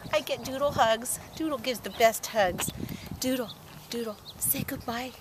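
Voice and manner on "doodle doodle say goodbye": whispered. Real, not acted. Owner whispering to her dog to say goodbye.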